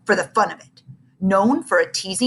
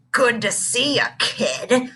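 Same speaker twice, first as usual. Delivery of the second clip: a hint of a low, growly bully; bully growling at a smaller kid; 4 semitones higher, slower than usual. A narrator's reading. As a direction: lightly growl it like a menacing older kid. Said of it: "growled a deep voice".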